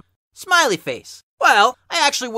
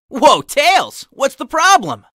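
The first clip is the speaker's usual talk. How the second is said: higher and louder, startled; startled call; 6 semitones higher, 6 dB louder, slower than usual. Acted. Steve startled as Tails runs up in terror, surprised shout. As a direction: jump at the sight of him.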